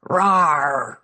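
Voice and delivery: mock toy-dinosaur roar; playful menace. A narrator's reading. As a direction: growl like a toy T-Rex. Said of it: "menacing the Brontosaurus".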